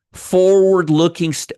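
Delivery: loud, high, drawn-out words; emphatic correction. Real, not acted. Correcting Stacy who's not getting it, loud and drawn-out. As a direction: spell it out loudly and slowly. Loudly drilling a phrase for Stacy.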